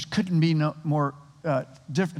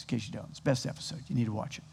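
quiet aside; 6 semitones lower, 13 dB quieter, faster than usual. Real, not acted. Aside urging them to watch.

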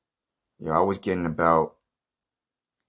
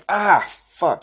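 much higher, louder mock cry of dismay; frustrated outburst; 12 semitones higher, 6 dB louder than usual. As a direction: blurt it as the throw goes wrong. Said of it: "And they're like".

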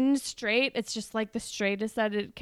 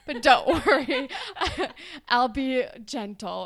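louder, laughing mock-flirty line; laughing through it; 3 semitones higher, 6 dB louder than usual. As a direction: laugh while teasing a promise. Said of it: "And he's like".

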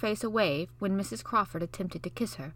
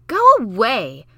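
loud, slow cry of go away; cried out; 3 semitones higher, 14 dB louder, slower than usual. Acted. Mary shouting at Basil, loud cry. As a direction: push him off with a loud cry. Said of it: "cried Mary".

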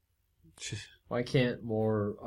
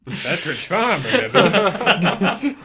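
put-on slow, louder, laughing old man; slow old man meme voice; 8 semitones higher, 10 dB louder, much slower than usual. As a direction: drag it out like the old Pepperidge Farm guy. Meme quote.

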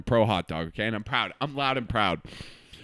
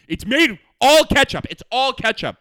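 imitating sister; 10 semitones higher, 10 dB louder than usual. Sister insisting barbecue sauce is ketchup, mock exasperated. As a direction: hammer it home. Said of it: "And she goes".

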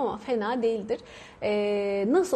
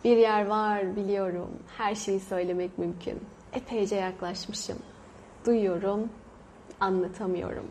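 slow, soft recited verse, fading at the end; poem recital; 3 semitones lower, 4 dB quieter than usual. Retold or imitated. Reciting a poem line to viewers, hushed and slow. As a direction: let each line hang, softly. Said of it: "dediği".

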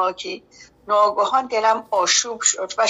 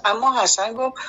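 plain reading voice

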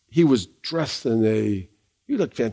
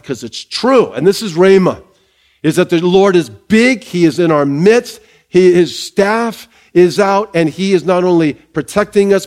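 rising, emphatic sermon delivery; full-force pulpit preaching; 3 semitones higher, 4 dB louder than usual. Acted. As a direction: preach it out to the church with conviction. Preaching to the congregation.

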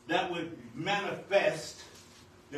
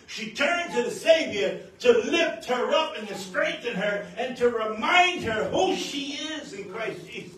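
rising, louder preacher's cry, slowing to a shout; fiery sermon climax, loud and slowing; full-force preaching; 6 semitones higher, 7 dB louder, slower than usual. Acted. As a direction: preach it home, building to a shout. Preaching surge.

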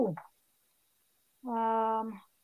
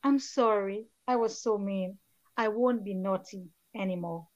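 soft, low, sinking remorse; tearful apology; 4 semitones lower, 8 dB quieter than usual. A narrator's reading. Author reads Kenny apologising to Mother Nature, quietly tearful. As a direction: read it as a subdued, sorry confession, trailing lower. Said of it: "tears breaking down afresh".

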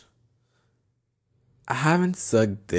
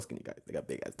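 whisper; 7 semitones lower, 14 dB quieter, faster than usual. Real, not acted. Secret about skinny guys.

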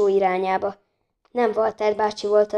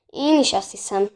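ordinary delivery

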